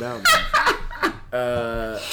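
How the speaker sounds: bursting into laughter; laughing. Real, not acted. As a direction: laugh through it. Laughs after cough.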